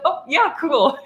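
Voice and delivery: high, strained and bright over a cry; faked cheer through tears. Girl in the back seat hiding tears from her friends, tearful fake cheer. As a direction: pretend you're fine while crying. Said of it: "the silent cry".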